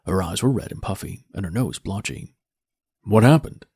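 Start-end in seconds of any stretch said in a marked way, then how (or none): none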